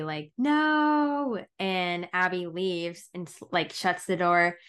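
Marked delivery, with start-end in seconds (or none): 0.4-1.4 high, drawn-out mock yell. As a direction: imitate a long yelled cry after someone. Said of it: "yelling to Abby".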